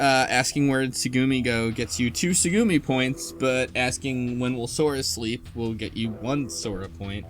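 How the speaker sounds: ordinary talk, nothing marked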